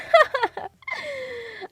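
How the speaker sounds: high burst of laughter; amused laugh. Real, not acted. Streamer laughs at own American impression, high burst. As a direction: laugh out at your own joke.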